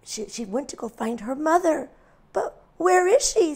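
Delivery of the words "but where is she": high worried lamb; anxious small voice; worried little lamb. A narrator's reading. Lost lamb calling for her mother. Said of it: "said Little Lamb".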